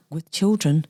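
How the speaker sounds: ordinary delivery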